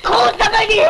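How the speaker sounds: very high, heated shouting back; angry retort. Acted. Rejecting blame from the crying woman, angry retort. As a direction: yell back in a strained high voice.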